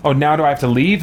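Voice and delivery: ordinary delivery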